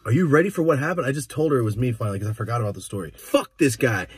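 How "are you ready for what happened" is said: ordinary talk, nothing marked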